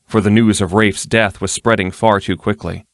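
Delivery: plain reading voice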